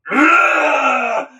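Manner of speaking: hammed-up monster roar; monster grunt. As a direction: lunge with a grunt. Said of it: "Frankenstein is like".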